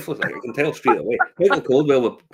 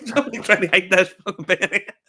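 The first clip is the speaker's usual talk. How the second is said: normal talking voice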